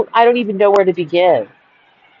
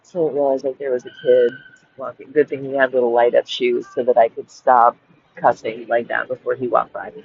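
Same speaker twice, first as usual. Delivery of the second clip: quiet, holding back the cursing; hushed; 10 semitones lower, 6 dB quieter than usual. Real, not acted. Hushing up mid-rant as a child walks past, softly. Kid walking by, stops cussing.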